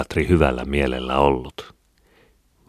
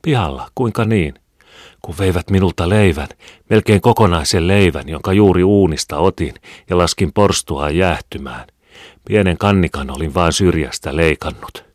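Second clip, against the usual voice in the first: agitated; 3 semitones higher, 6 dB louder than usual. A narrator's reading. Agitated quarrel over stolen bread.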